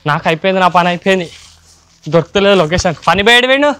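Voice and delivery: loud, rising panic; panicked. Real, not acted. Can't find a hiding spot.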